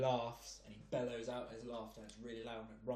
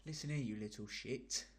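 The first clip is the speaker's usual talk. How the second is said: put-on London geezer accent; 2 semitones higher than usual. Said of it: "in a London accent, like a proper geezer".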